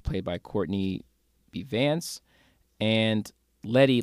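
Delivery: ordinary talk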